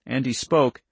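plain reading voice

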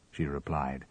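usual speaking voice

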